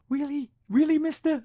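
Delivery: put-on cute little kid voice. Fan imitating little Bailey asking Hop Pop, high cute kid voice. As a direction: ask it wide-eyed like a tiny eager kid. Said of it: "I just love that voice".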